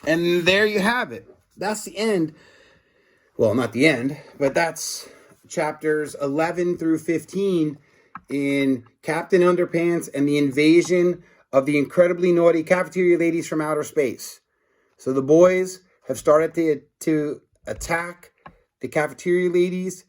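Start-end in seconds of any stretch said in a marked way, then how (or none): none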